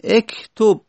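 اکتب as "ektob", moving from "e" to "o"; kasre to damme. Shown as a demonstration. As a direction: show the move from "e" into "o" in اکتب. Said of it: "از کسره به دمه رفتن سخته".

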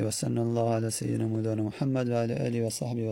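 soft, low closing blessing; closing prayer. Acted. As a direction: close the lesson with a quiet prayer. (دعای پایانی آرام و آهسته)

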